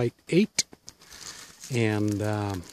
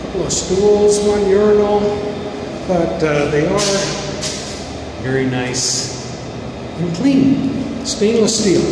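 booming echo off the tile walls; echoey bathroom; 6 dB louder than usual. Real, not acted. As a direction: speak in a bare echoing restroom. Talking inside the campground bathroom.

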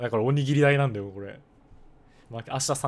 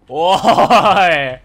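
long, laughing shout of protest; 笑いながらの叫び; 4 semitones higher, 13 dB louder, much slower than usual. Real, not acted. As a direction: shout "oi" drawn out, breaking into laughter. ゲーム中に笑って突っ込む. (笑いながら大声で伸ばして突っ込む)

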